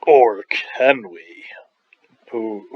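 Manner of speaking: slow, stagey whisper; suspenseful whisper. Pretend. Lecturer teasing the class with a twist, suspenseful whisper. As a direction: whisper it slowly like a teaser.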